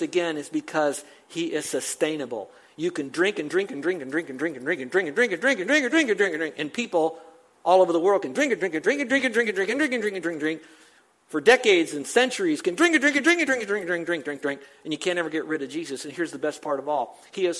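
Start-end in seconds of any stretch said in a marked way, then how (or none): 2.8-6.5 repetitive rolling run, pitch bobbing and climbing; sing-song pile-up of repeats; endless drinking run. Real, not acted. Piles up endless repetition for effect. 8.2-10.6 fast, higher bobbing repetition; endless drinking run. Real, not acted. Piles up endless repetition for effect. 12.6-14.6 high, fast tumbling repetition; endless drinking run. Real, not acted. Piles up endless repetition for effect.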